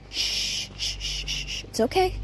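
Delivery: shushing. Real, not acted. Hushing in danger.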